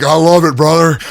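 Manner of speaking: wrestling star cheering a voice impression, loud; Stone Cold impression. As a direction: Austin hyping it up with a loud brother. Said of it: "He's like".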